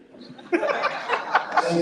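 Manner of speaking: laughing. Real, not acted. Laugh after joke.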